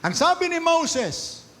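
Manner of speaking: high, loud, slow cry of Moses' name; thundering preaching. Real, not acted. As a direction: proclaim it like a judgement from the pulpit.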